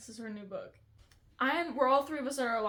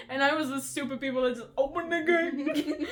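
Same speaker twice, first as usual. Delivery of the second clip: laughing; 2 semitones higher, 4 dB louder than usual. Real, not acted. Joking about being outplayed.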